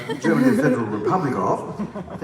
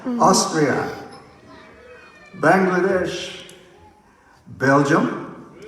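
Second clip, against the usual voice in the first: raised roll-call voice; 5 semitones higher, 3 dB louder, much slower than usual. Real, not acted. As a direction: call each name out to the hall. Roll call of countries to crowd.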